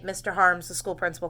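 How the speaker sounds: ordinary delivery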